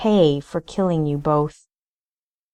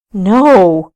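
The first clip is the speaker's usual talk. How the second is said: a loud, raw cry of denial; anguished shout; 9 dB louder than usual. A narrator's reading. Paxton cries out to the ghosts, a loud anguished no. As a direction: blurt it out, stung.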